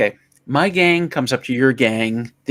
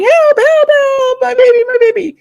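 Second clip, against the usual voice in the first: very high, loud mock screaming; hammed-up panic; 9 dB louder than usual. Mother at burning window in a joke, mock panicked screams. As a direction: wail for help in a shrieky falsetto.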